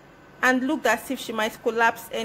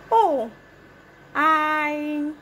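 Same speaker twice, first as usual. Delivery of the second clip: cartoon princess; 4 semitones higher than usual. A narrator's reading. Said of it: "replied the princess".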